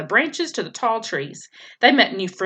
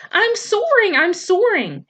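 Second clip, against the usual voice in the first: high, thrilled little bird voice; excited gosling; 10 semitones higher, 3 dB louder than usual. A narrator's reading. Gosling tells his mother he is soaring, high and thrilled. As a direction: cry it out in wonder at flying.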